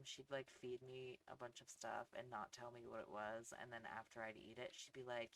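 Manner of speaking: ordinary talk, nothing marked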